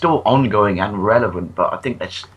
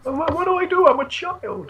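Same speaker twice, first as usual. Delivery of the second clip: much higher mock little-kid voice; helpless child. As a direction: play the clueless tiny kid. Joining the helpless child joke.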